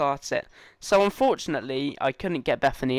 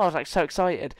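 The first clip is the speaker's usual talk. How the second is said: normal talking voice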